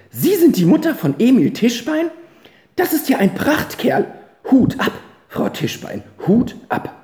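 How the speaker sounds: put-on heartily impressed by the boy; delighted gentleman. Gentleman to the mother, high and delighted. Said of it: "meinte der Mann erfreut".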